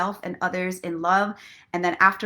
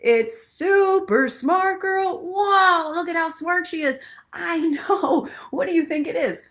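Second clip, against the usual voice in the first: higher, louder hammy announcer; superhero shtick; 9 semitones higher, 3 dB louder than usual. Pretend. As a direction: present the hero like a cheesy cartoon. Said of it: "that shtick".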